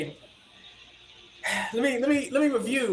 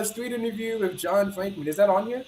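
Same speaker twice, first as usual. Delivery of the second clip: ordinary delivery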